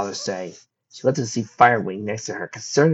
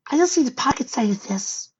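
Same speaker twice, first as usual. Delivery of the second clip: put-on young filly voice. Fireside's voice.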